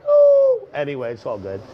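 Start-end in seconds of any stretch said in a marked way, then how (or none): 0.1-0.7 very high, loud hoot; sudden high hooted "ooh"; high-pitched ooh. Acted. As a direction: let out a piercing falsetto ooh. High hoot after mocked viewer exit.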